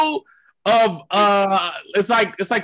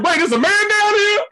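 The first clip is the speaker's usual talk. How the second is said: high startled question; confused surprise; 10 semitones higher than usual. Retold or imitated. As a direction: act out a startled guy. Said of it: "dudes already eating ... confused".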